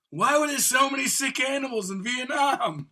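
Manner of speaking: high put-on character voice; mock dumb question. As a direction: ask it like a confused clown. Retelling the veteran veterinarian bit.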